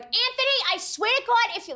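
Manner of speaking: put-on high, mad-at-Anthony Staten Island accent; Italian-American Staten Island accent. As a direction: imitate a scolding Italian-American New Yorker. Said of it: "everyone there just sounds like".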